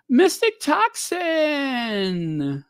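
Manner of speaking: high, long drawn-out mock proclamation; mock-grandiose exclamation. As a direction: stretch it out high, sliding slowly down. Mocks the writer's florid phrase.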